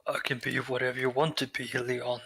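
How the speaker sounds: soft, suggestive game shopkeeper; quiet put-on vendor voice with innuendo; Resident Evil Merchant. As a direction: flirt softly as a creepy vendor. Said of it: "the merchant from Resident Evil".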